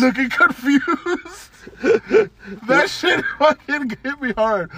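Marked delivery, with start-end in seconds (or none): 1.7-2.6 a high, real laugh bubbling out; burst of high laughter. Real, not acted. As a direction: crack up at the joke. Laughing at Reedus joke.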